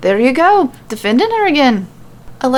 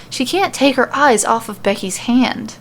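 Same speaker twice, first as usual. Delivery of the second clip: ordinary delivery, nothing marked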